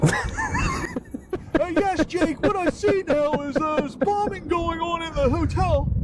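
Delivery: mock correspondent describing chaos on the call; war reporter. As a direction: report live from a bombed hotel. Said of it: "C N N reporter".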